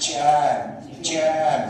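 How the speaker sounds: "জ্যাম" with the vowel pulled a little long, falling; drawn-out jam. Shown as a demonstration. Teacher demonstrating English jam to students, drawn out. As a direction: stretch "জ্যাম" slightly, let it fall. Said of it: "একটু এরকম টানে বলবে".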